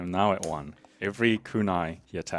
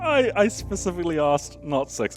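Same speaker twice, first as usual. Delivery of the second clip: mock outrage; 10 semitones higher, 5 dB louder than usual. Real, not acted. Complaining about the roll.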